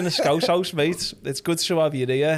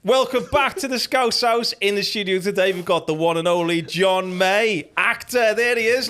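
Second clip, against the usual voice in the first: upbeat, higher host welcome; show host intro; 4 semitones higher than usual. Acted. Host welcoming the guest on the podcast, upbeat. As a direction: open the show with energy.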